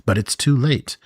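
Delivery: ordinary delivery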